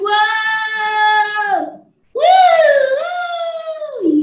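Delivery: high, loud, drawn-out whoa and woos; long singsong squeals of delight; excited whooping. Real, not acted. Playing with the fluffy dog page.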